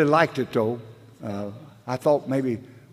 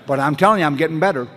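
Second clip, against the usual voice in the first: emphatic loud insistence; 5 semitones higher, 9 dB louder, faster than usual. Real, not acted. Speaker assuring the meeting he's improving, loud and emphatic. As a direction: punch it out loud and earnest. Stressing his own progress.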